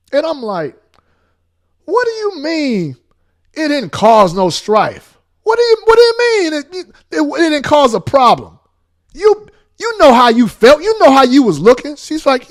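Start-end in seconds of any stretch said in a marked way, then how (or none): 1.8-12.0 much higher, louder, incredulous rant; exasperated high voice. Retold or imitated. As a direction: pitch rising in outrage. Heated quarrel with wife in car.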